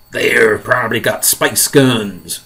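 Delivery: usual speaking voice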